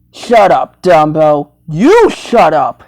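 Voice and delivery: lightly voiced low scolding figure; mock scolding. Bickering with Dumbo, mock scolding.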